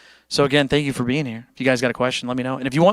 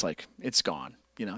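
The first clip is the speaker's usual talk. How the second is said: normal talking voice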